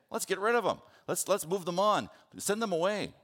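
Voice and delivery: dismissive, high and loud, mocking the disciples; snarky. Preacher voices disciples wanting the crowd gone, snarky. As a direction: push it out high and loud, impatient to be rid of people. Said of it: "they get kinda snarky".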